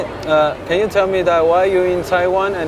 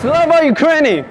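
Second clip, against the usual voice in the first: roaring rallying speech; 13 semitones higher, 5 dB louder than usual. Real, not acted. Leads crowd in chanted pledges.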